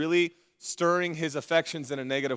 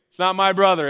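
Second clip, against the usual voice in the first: brother punched louder; stress on brother; 4 dB louder than usual. Shown as a demonstration. Pastor explains the son's slight, stress on brother. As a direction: lean on brother to mock. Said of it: "Look at me".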